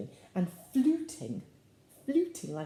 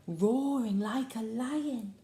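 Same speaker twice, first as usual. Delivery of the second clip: slow, higher, stretched-out roaring words; lion-like roar; 5 semitones higher than usual. Shown as a demonstration. Reader leading children in animal sounds, roaring. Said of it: "you need to do it now while I say it".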